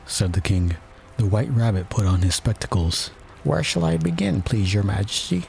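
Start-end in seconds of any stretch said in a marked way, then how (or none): 3.4-5.4 high-pitched royal attendant. A narrator's reading. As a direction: pipe up high to the king. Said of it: "he asked".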